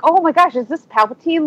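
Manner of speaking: retold surprise. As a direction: relive a puzzled first reaction. Retelling her surprised reaction.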